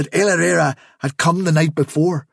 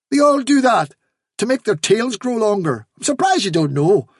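higher, insistent know-it-all; lightly voiced Hafsa the rabbit; 7 semitones higher than usual. A narrator's reading. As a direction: explain it as if obvious. Hafsa lectures the fox.

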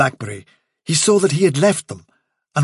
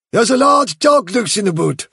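higher, louder, pressing warning; a tough rabbit urging the leader to move now; breathless soldier rabbit; Bigwig's urgent voice; 8 semitones higher, 5 dB louder than usual. A narrator's reading.

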